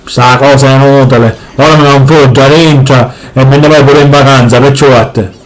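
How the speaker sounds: low, heavy father voice; gruff Sicilian dad. Acted. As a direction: boom it out like a grumpy papà. Father again.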